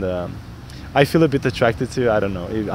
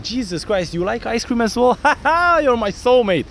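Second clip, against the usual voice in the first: high, playful mock amazement with a laugh; excited banter; 8 semitones higher than usual. Shown as a demonstration. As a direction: gush like you just found your soulmate. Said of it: "Very often I'm gonna do this".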